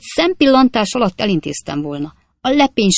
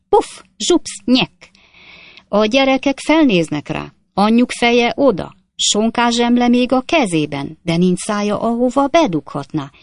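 higher and louder, gleeful horror tale; lurid anecdote; 6 semitones higher, 5 dB louder than usual. Acted. Stranger tells passengers a grisly archway tale, gleeful and loud. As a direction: relish every gruesome beat.

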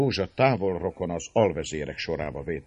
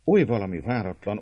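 ordinary delivery, nothing marked

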